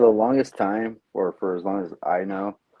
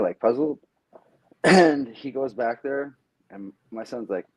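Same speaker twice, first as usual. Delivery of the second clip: usual speaking voice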